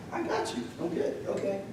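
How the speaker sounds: softly imitating a shrug-off; casual whisper. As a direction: toss it off under your breath like it's nothing. Rodney shrugging off his cancer news.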